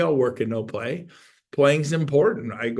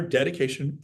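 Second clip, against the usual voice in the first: usual speaking voice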